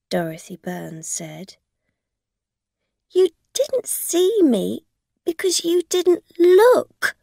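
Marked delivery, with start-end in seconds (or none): 3.1-7.1 high, loud angry retort; accusing the driver angrily. A narrator's reading. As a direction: snap it back at her. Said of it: "Rosa said angrily".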